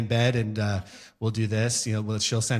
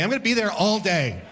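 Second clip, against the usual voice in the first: normal talking voice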